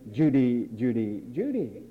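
low, teasing call of a name; low sing-song chiding. Acted. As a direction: call her name like a weary parent. Preacher mock-scolds a listener.

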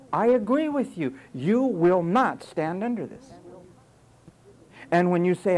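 loud, pounding sermon; full-force preacher; fiery preaching. Acted. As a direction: preach it hard at the congregation.

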